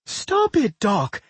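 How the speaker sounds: high-pitched alarmed screech. A narrator's reading. Claire orders Doc off the girl, screeching. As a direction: screech it out in panic. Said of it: "Claire screeched as well".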